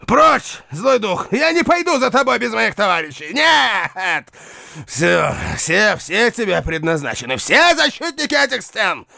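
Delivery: raving madman, high and shrill; seething shrieking spirit. Acted. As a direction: rant high in mad defiance. Raving knight facing fire.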